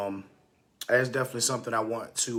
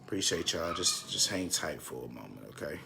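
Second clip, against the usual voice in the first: distracted murmur; 6 semitones lower, 9 dB quieter than usual. Real, not acted. Asking viewers to wait while busy.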